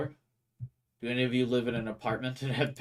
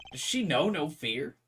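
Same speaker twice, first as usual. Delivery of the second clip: usual speaking voice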